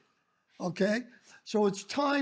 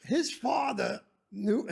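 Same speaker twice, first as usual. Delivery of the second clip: usual speaking voice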